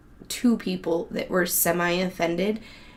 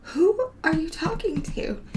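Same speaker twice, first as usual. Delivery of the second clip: high, slow, drawn-out mock question; slow, high-pitched mock bafflement; imitated puzzled boss; 8 semitones higher, slower than usual. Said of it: "He was like".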